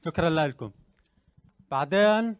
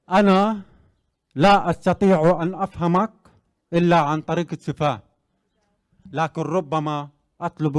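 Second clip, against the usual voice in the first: louder, declaimed speech in classical Arabic; formal speech; 4 dB louder than usual. Acted. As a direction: address the hall like a podium speaker.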